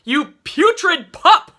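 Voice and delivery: furious high, loud, slow insult; enraged bellowed insult; abusive insult. A narrator's reading. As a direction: hurl it while shaking him. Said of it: "a lurid assortment of abusive epithets".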